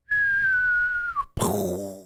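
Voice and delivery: very high squeal of laughter. Real, not acted. Friend laughing at thoughts of Keith. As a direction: lose it at the mental image.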